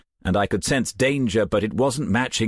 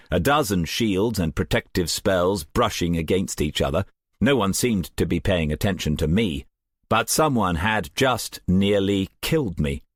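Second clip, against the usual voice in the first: ordinary delivery, nothing marked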